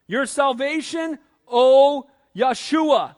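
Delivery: slow, loud, fervent cry to God; dying father's loud, drawn-out prayer. Retold or imitated. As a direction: plead slowly, rising to full voice on Lord. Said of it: "He cries out for salvation".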